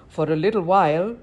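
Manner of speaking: ordinary delivery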